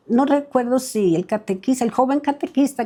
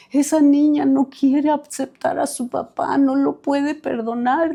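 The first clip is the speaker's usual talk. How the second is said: imitated crying; 4 semitones higher than usual. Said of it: "por qué lloraba".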